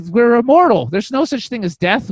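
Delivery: excited revelation. Retold or imitated. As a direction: announce it like a revelation. Retells revelation of immortality.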